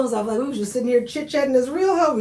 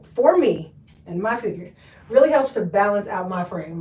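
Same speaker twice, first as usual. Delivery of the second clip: normal talking voice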